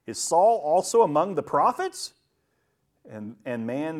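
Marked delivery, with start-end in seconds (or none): 0.1-2.1 loud, high, rising disbelief; incredulous question. Acted. People who knew Saul questioning his prophesying, incredulous. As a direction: ask it loudly, pitch swinging upward. Acts the astonished people in scripture.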